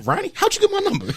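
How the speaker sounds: high mock voice. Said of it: "He was like".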